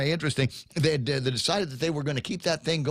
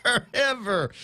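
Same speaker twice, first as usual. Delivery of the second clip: laughing; 7 semitones higher, slower than usual. Real, not acted. Echoes clip while laughing.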